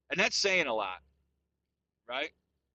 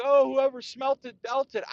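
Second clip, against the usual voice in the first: high, loud singsong taunt; mocking schoolkid taunt; 9 semitones higher, 7 dB louder than usual. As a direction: tease like a kid on the playground. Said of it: "I remember being in school and you're like".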